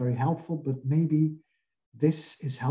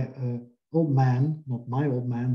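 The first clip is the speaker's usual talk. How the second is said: ordinary talk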